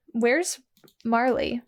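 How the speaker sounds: ordinary talk, nothing marked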